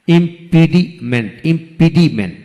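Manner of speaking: ইম্পিডিমেন্ট in beats, im, pidi, ment; split into syllables. Shown as a demonstration. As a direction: gaps inside ইম্পিডিমেন্ট. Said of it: "তিনটি syllable থাকে".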